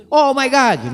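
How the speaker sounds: a hammed-up Hollywood gasp of alarm; like a passenger on a falling plane, lightly acted; mock panicked exclamation. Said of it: "Aquele pavor, aquele pânico".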